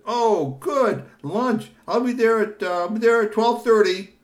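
high, loud insistent offer; loud eager Kashi. Acted. Kashi insisting on lunch, eager. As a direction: push the lunch plan.

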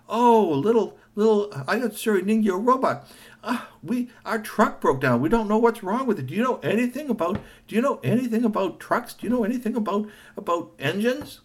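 high, eager pleading; pleading vendors. Acted. Vendors asking the robot about trucks, high pleading. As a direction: beg the robot for help.

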